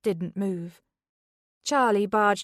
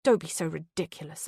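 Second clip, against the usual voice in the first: ordinary delivery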